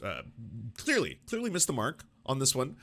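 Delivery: usual speaking voice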